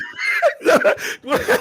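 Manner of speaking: high squealing burst of laughter; shrieking laugh. Real, not acted. Host cracks up when his co-host refuses the interview, high shrieking laugh.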